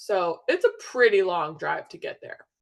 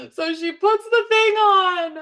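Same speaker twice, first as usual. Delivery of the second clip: higher, louder, slow voice cracking with emotion; voice breaking; 13 semitones higher, 10 dB louder than usual. Real, not acted. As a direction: fight back tears on the words. Emotion rising at the ultrasound.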